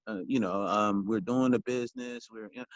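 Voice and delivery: low, droning, hesitant monotone; Clear Eyes commercial monotone. Shown as a demonstration. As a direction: mumble the pitch with no energy at all. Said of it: "sound like the Clear Eyes commercial".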